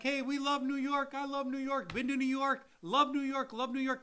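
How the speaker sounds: high, chanted mock gushing; gushing enthusiasm. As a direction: reenact an over-eager, repeated sales cheer. Imitates their repeated upbeat pitch.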